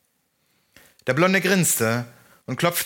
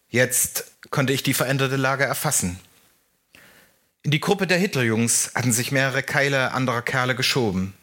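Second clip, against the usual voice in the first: ordinary delivery, nothing marked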